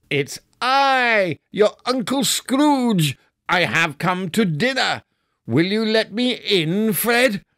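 joyful Scrooge. A narrator's reading. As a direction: bubble over with goodwill.

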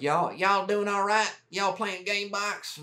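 high, slow Texan drawl; Texan drawl impression. First sergeant checking on soldiers, in a slow high Texan drawl. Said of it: "Your impression of him doesn't sound anything similar to your original accent".

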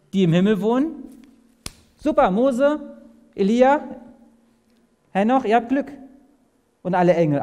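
high, loud playful congratulation; hammed-up delight; mock cheer. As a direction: cheer them on in jest. Mock reaction to the verse.

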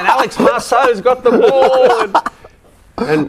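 high, laughing call of play; hammed-up race-caller voice; mock footy commentator. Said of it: "He used to call me Marceau".

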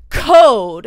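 loud, curt one-word order; a shouted imitation of a pushy guy; mock barked demand. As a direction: bark it like a boss demanding work. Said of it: "It's just like".

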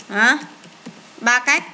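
usual speaking voice

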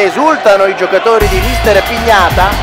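normal talking voice

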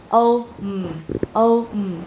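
O, then a separate hummed M; sounds split apart. Shown as a demonstration. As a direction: break "home" into O and a closed-lip M. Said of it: "Chữ M thì mình khép miệng lại". (tách rời âm O và âm M ngậm môi)

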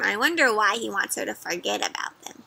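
ordinary delivery, nothing marked

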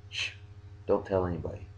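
shush, then very soft and low; mock secretive. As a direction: hush it like a playful secret. Jokingly shares a secret with viewers.

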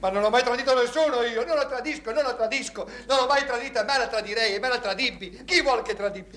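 high, loud, insistent denial; frantic protest. Acted. Man tells friend he never betrayed her, frantic. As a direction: protest innocence hysterically.

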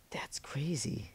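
slow, hushed whisper to himself. Real, not acted. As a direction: breathe it out in amazement. Surprised by the game result.

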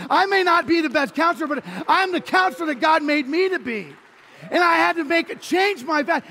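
loud, rising sermon climax, each line pushed harder; preaching at full force. Acted. Preacher declaring to the congregation he is what God made him. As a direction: build it like a revival preacher driving home the point.

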